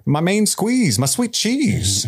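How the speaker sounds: high, drawn-out mock endearments; Viserys. King trying to comfort his brother, hammed-up high pet names. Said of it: "comforts Daemon, or tries to".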